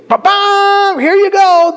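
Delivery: sudden high, loud burst of a handover; excited gift reveal. Pretend. Pastor handing the congregation a gift, showy excited burst. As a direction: pop it out like a showman presenting a prize.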